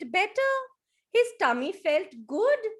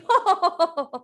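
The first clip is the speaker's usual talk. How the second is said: loud hearty laugh; 6 semitones higher, 9 dB louder than usual. Acted. Elephant laughing while tickled.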